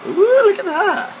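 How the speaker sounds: very high, loud delighted squeal; excited. Real, not acted. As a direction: coo over it, thrilled. Seeing the finished cake.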